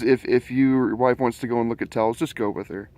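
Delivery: usual speaking voice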